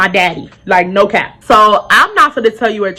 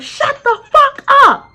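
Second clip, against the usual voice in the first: very high, drawn-out mock yell; squealed, stretched-out angry command; angry man's retort; slower than usual. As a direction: imitate him hollering it slowly. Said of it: "So he said".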